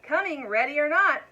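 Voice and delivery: high, loud hide-and-seek yell. A narrator's reading. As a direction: call out to the hiding demon, playful threat. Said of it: "yelling all the while".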